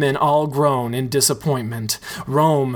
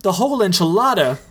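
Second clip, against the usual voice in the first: high, fast, amazed echo; shocked echo; 6 semitones higher, faster than usual. Acted. Richie echoing the routine name, fast and amazed.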